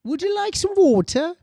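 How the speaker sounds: very high, put-on British accent. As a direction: mimic a proper British voice in a high pitch. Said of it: "so proper sounding about the British accent".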